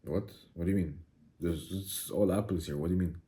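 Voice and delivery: low, soft, mock bewilderment; quietly confused, acted; puzzled reply. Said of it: "you look at me and you're like".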